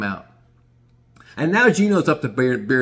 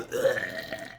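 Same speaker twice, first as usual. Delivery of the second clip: breathless voiceless retch; girl hurling over the side, voiceless retch; retching groan; 3 semitones lower, 8 dB quieter than usual. Retold or imitated. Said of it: "She's sitting there going".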